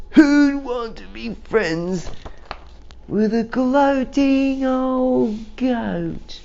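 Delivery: high, slow sneering voice; mocking townsfolk. People below jeering at the goat, high and slow. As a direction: jeer slowly up at him.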